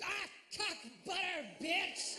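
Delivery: high, strained yelp with little volume; hammed-up, badly faked rage; mock mad. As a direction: squeal a silly curse in pretend anger. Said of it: "You can get mad".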